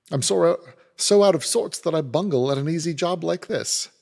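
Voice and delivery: a hint of a soldier at his wits' end; lightly voiced upset trooper. A narrator's reading. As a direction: blurt it out, embarrassed and shaken. Trooper out of sorts.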